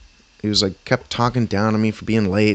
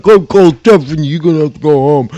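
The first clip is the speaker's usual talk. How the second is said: loud, high-pitched mock threat; hammed-up coworker shouting an order; imitated yelling; 6 semitones higher, 11 dB louder than usual. Coworker threatening to send him home, mock shouting.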